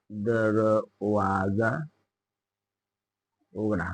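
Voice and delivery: very slow, soft, stretched syllables; slow dictation. Shown as a demonstration. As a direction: break दरवाजा into drawn-out syllables. Teacher repeats slowly for dictation. (अगदी सावकाश, हळू आवाजात)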